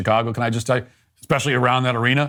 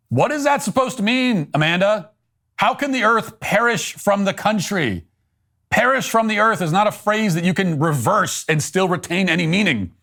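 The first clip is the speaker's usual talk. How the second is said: higher-pitched, baffled demand for sense; incredulous challenge; 6 semitones higher than usual. Real, not acted. Commentator challenging the poet's nonsensical line, incredulous. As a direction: question it in exasperated disbelief. Mock-interrogating the poet over her line.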